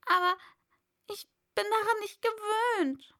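high, tearful and pleading; high weepy plea; whining. A narrator's reading. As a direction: near tears, defending herself. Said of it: "in weinerlichem Tone".

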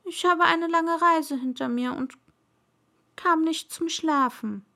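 a touch of a weary little prince; tired prince. Acted. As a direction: explain helplessly to the king. Prince explains to king.

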